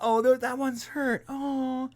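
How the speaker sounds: high, cooing mock sympathy; imitated pitying aliens. Retelling the movie's cute aliens scene, mock pity. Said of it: "And they're like".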